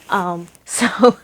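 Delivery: ordinary delivery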